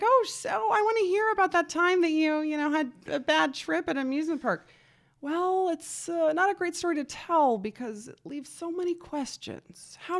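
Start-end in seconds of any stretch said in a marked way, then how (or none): 0.0-4.7 high, loud gushing request; eager askers. Acted. People pestering her for the bad trip story, eager askers. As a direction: play the nosy crowd, pitched way up. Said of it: "which people are always like".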